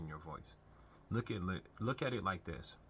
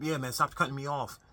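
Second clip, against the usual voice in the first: higher, much louder annoyed outburst; irritated snap; 6 semitones higher, 9 dB louder than usual. Shown as a demonstration. Showing the wrong way to answer a partner, loud and annoyed. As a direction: bark it out like you're fed up with being interrupted. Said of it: "you can go the route of".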